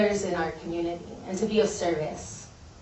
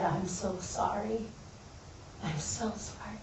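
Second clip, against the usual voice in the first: hushed apology; 12 dB quieter than usual. Retold or imitated. Looking up to her dead grandparents, hushed apology. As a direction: apologize quietly, the repeat fading further.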